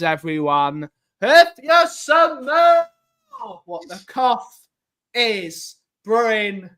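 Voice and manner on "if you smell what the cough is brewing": very slow, high, drawn-out hype voice; wrestler catchphrase. Pretend. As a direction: stretch it out like a ring announcer. Sign-off catchphrase.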